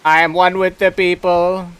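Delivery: robot voice. Pretend. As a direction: announce yourself like a machine. Gamer playing robot character.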